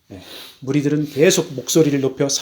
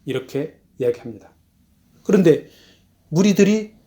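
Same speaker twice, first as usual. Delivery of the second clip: usual speaking voice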